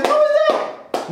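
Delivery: high squeaky slow question; alarmed girlfriend. Retold or imitated. Mimicking his girlfriend's slow suspicious squeak. Girl repeats question, retold.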